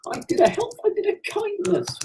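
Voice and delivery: very high, childlike mock pride; squeaky little self-congratulation; squeaky falsetto. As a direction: pipe it up in a tiny falsetto.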